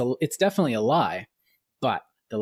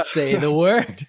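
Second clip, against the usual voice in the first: teasing; 4 semitones higher, 6 dB louder, slower than usual. Retold or imitated. Teasing mock demand.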